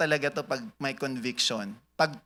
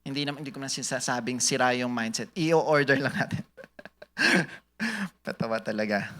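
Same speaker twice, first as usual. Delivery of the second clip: normal talking voice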